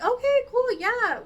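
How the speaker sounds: high, loud eager okay cool; excited okay. Retold or imitated. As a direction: jump at the invitation. Accepting the party invitation.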